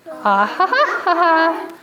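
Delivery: slow ha's jumping up high; sung ha-ha-ha. Shown as a demonstration. Teacher repeating the ghost reveal, high ha-ha sound.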